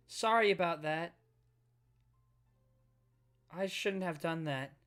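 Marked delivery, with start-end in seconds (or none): none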